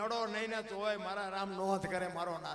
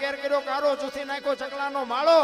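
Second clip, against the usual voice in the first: loud, rhythmic chanted recital of a ballad; fiery dayro-style recitation of a village poem; folk poem recitation; 5 semitones higher, 13 dB louder than usual. Acted. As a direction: declaim each alliterative line in a driving rhythm, rising to a shout at the end. Recites Bhikhabhai Rabari's earthquake poem.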